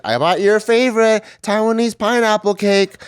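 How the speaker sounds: high mock mom voice; imitating mom. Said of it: "she's like".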